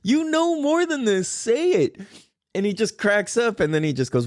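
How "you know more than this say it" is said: high-pitched, exasperated dad pleading; hammed-up high protest; mock exasperation. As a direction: squeal it in playful disbelief at your kid. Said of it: "I'm like".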